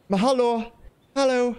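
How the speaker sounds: calling out. Acted. Calling out to fish.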